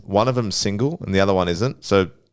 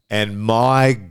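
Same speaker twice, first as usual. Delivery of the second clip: mock despair; much slower than usual. Real, not acted. Mock lament about being old.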